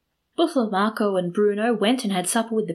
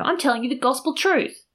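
high, insistent exclamation; acted indignant protest; exclaimed protest; 6 semitones higher than usual. A narrator's reading. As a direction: insist on being believed, pitched up. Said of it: "exclaimed Calandrino".

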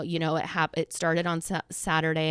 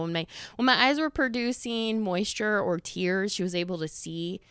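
ordinary talk, nothing marked

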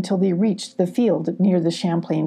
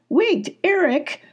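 much higher, slow call; crying out; 10 semitones higher, slower than usual. A narrator's reading. Sylvie calling after Eric, much higher. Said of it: "cried Sylvie".

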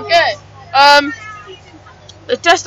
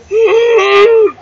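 gasp; 8 semitones higher, 4 dB louder than usual. Retold or imitated. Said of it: "You're gonna be like".

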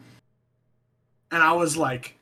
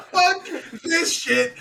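very high, drawn-out retold exclamation; slow, high squeal with laughter in it; comic panic; 10 semitones higher, 3 dB louder, much slower than usual. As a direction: re-enact bailing out in alarm. Retold fleeing the ghost.

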